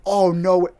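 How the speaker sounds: mounting shock. Retold or imitated. Moviegoer reacting to the ending, increasingly shocked. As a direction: escalate from dismay to loud astonishment.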